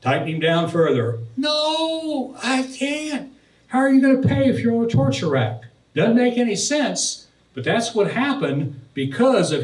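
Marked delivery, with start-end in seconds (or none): 1.4-3.3 high strained cry of pain; mock anguished no. Victim cries out again. 3.7-7.3 high, fast, disbelieving exclamation; incredulous preacher voice. Real, not acted.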